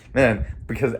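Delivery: usual speaking voice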